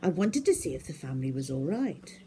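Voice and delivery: ordinary delivery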